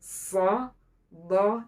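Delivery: thickened s and d in "So, do"; thick, heavy consonants. Shown as a demonstration. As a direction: make "So, do" full and heavy. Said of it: "Tengah-tengah lidah diangkat".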